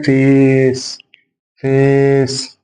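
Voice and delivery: فيس drawn out slowly as "faaace"; long vowel. Shown as a demonstration. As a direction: hold the ay in فيس long and level. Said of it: "فياخد الصوت الطويل اللونج". (فيس بالصوت الطويل)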